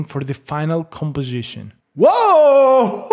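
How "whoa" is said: loud, high burst of amazement; amazed whoa. Real, not acted. Tech vlogger stunned by the image, loud amazed whoa. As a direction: blurt out real shock at the screen.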